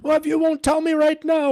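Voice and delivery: high, loud, shouting mockery of an online attacker; mock angry troll. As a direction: ham up a shrill internet troll demanding answers. Mocking the attacker again.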